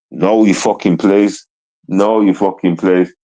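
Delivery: low, slow and deliberate, every word weighed; a cold, measured threat; slow menacing warning. Real, not acted. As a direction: lay down the law slowly. Warning off a critic.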